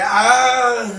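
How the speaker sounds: high laughing ah; a startled ah that dissolves into laughter; laughing gasp. Retold or imitated. Retold startled reaction, laughing.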